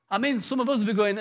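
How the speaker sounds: ordinary talk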